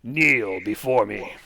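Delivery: slow commanding villain voice; dark lord. Acted. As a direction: intone it like a cult leader demanding worship. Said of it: "He is going to say".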